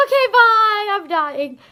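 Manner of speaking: high, loud, hammed-up goodbye; mock-desperate farewell. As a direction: call out bye as if being abandoned. Mock farewell to departing friend.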